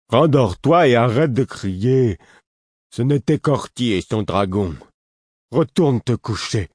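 high, loud grumpy complaint; whining order. A narrator's reading. Woken Lourd telling the prince to stop shouting, whining. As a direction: whine it as an order, dragging the words. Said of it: "entre un ordre et une plainte".

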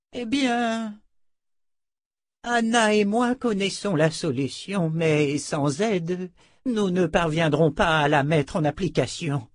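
put-on high, slow, effortful old man; weary old wizard. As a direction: stretch it out in a strained aged voice. (vieux sorcier épuisé, voix haute)

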